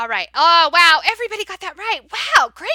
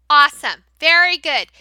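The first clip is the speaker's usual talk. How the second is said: normal talking voice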